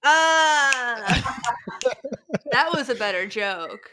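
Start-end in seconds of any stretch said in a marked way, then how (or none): 0.0-1.3 higher, louder yelp of laughter; loud laughing shout. Real, not acted. Burst at the joke.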